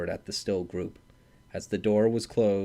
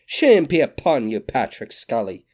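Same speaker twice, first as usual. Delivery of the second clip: loud reproach; 3 semitones higher, 7 dB louder than usual. A narrator's reading. Mother repeats her reproach.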